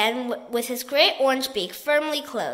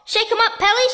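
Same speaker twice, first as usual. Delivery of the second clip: higher, louder rallying shout; 8 semitones higher, 5 dB louder than usual. A narrator's reading. Giraffe urging the pelican to shake the burglar, shouting. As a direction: egg the bird on loudly. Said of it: "shouted the giraffe".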